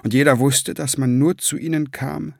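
ordinary delivery

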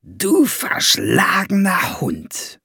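slow, knowing aside; murmured aside. A narrator's reading. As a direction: savour every syllable, insinuating. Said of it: "raunte er ihm zu".

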